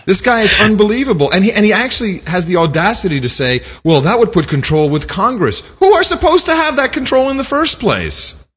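high, indignant rant; incredulous outrage. Real, not acted. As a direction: sound appalled at the audacity, pitched high. Outraged reaction to played clip.

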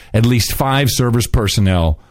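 ordinary talk, nothing marked

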